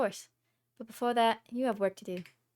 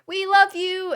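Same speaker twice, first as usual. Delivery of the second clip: high, loud fan cheer; cheering band parent; 10 semitones higher, 11 dB louder, much slower than usual. Retold or imitated. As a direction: cheer from the stands like a proud parent. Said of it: "they would go".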